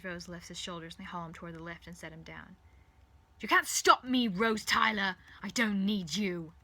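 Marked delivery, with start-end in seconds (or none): 3.4-6.5 loud, high-pitched villainous outburst; furious raised voice; angry shout. Acted. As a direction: shout after someone defying you. Villain shouting after Rose.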